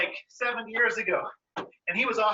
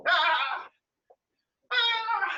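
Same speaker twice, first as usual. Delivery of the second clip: being chased; 7 semitones higher than usual. Pretend.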